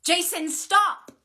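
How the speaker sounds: high, loud, urgent shout; desperate yell at full voice; scream. A narrator's reading. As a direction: scream to stop him mid-strike. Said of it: "she screamed".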